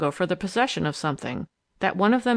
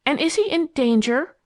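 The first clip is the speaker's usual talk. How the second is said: high, louder, urgent question; persistent; 8 semitones higher, 5 dB louder than usual. A narrator's reading. Phyllis asking if Ted is in danger, persistent. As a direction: press urgently, voice lifting. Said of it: "persisted Phyllis".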